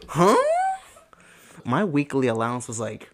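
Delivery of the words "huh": high, thin, incredulous reaction; squeaky hammed-up confusion; mock bafflement. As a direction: play up stunned disbelief. His baffled reaction to friends' allowances.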